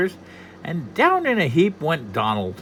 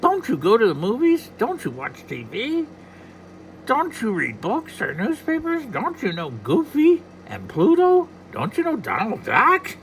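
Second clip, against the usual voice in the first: high, huffy cartoon duck; Donald Duck voice; 10 semitones higher than usual. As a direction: scold like an exasperated duck. Donald Duck scolds leprechaun.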